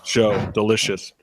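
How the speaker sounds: chewing, slow, mouth full of apple. Real, not acted. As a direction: savour the bite while talking. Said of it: "I'm eating an apple".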